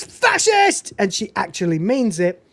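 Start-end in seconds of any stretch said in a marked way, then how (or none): 0.0-0.9 high-pitched retold shout; mock shout. Narrator acts out Joyce from the check-in, high mock shout. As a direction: cry it out high, imitating her. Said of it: "she this time shouts".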